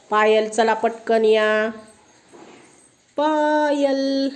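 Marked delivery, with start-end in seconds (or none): 3.2-4.3 long, high sing-song call of the name; slow lilting call; drawn-out call. Real, not acted. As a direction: stretch the name out to call her over. Teacher calling child forward.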